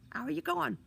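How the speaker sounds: high, loud squeak; squeaky kid shouting down. A narrator's reading. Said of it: "The voice squeaked again".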